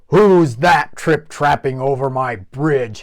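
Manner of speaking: loud troll bellow; twice-denied troll, furious. Acted. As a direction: bellow it angrily. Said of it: "he bellowed".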